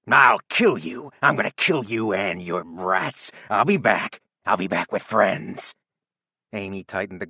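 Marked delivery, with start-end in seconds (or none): 0.1-5.8 raging scream. A narrator's reading. Bloodied Rob at the door threatening Amy, raging. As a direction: spit the threats in rage. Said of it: "He screamed in rage".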